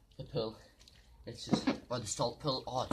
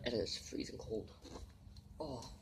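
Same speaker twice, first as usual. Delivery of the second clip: ordinary talk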